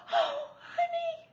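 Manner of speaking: loud high gasp of shock. Acted. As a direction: gasp at the sight. Shocked at husband's face.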